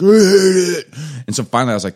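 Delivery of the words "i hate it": high, loud whining protest; angry kid. Retold or imitated. Son complains to dad about mornings, loud high protest. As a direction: shout it like a furious sleepy child.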